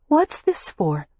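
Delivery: a touch of unease in a lightly voiced young woman; uneasy. A narrator's reading. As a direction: ask it warily, unsure of him. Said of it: "she asked uneasily".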